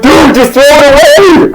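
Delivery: high, slow acted exasperation; exasperated. Telling homie to throw the chip away, exasperated. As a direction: draw it out as it climbs.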